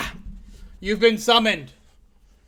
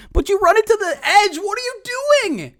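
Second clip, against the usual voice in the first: pitched way up, yelling in disbelief; real frustrated outburst, much higher; exasperated yell; 3 dB louder than usual. Real, not acted. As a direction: shout it at the coordinator in outrage. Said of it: "So it turns out I am mad".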